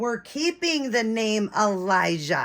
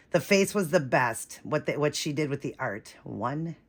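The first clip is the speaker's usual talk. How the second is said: ordinary delivery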